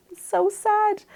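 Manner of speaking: retold high, squeaky lament; mock-tearful pity. Retold pity for the young nun.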